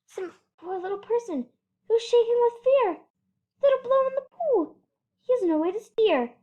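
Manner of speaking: anxious elephant; worried Horton. Acted. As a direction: fear for the little person. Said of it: "Horton worrying aloud".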